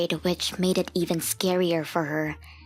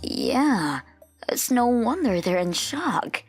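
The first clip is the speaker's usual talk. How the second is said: slow, higher-pitched worried parent; concerned mother; 5 semitones higher, slower than usual. A narrator's reading. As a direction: agree with drawn-out sympathy. Mother agrees with father.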